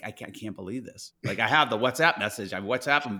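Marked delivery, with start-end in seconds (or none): 0.0-1.1 hushed, softly retold disbelief; quiet, understated choked-up moment; holding back tears. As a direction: say it very softly, as if retelling near-tears. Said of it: "I had to stop myself from, from crying".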